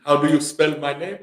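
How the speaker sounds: normal talking voice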